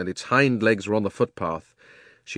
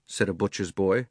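ordinary delivery

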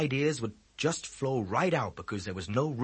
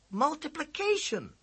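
high, slow, drawn-out disbelief; stunned by the news; shocked question. A narrator's reading. Boy stunned to hear it's multiplication.

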